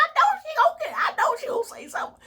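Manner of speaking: squealing high with laughter; giddy laughing squeal; laughing shout. Real, not acted. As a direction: shriek it out in glee. Excited prediction, laughing shout.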